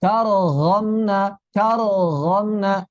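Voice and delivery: 'Karo romna' with released r, then throaty gh; wrong bouncing ro. Shown as a demonstration. Teacher combines both mistakes, faulty ro. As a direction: bounce 'Karo', swallow the r of 'romna'. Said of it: "Ini kekeliruan".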